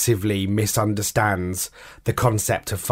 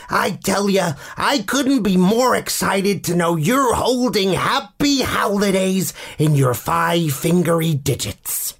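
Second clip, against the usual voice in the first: high, drawn-out American dog voice; excitable cartoon dog with a questionable American accent, pitched way up and stretched slow; Junior's American accent; 9 semitones higher, slower than usual. Shown as a demonstration. Author reads the dog's diary for young listeners, high excited American accent. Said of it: "you're about to hear my questionable American accent".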